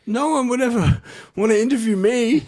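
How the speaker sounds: high-pitched mock French accent breaking into laughter; Nico. Guest voicing workmate envious of interview, French accent. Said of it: "what's the French accent?".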